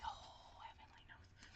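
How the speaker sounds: whispered under the breath, exasperated. Retold or imitated. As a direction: breathe it out like a private groan. Inner reaction to a bad cashier.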